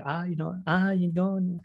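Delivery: a light, half-hearted Welsh lilt; a sketchy attempt at a Welsh accent; a Welsh accent that trails off unfinished; Michael Sheen Welsh accent. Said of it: "when he starts going like".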